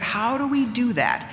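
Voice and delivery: ordinary delivery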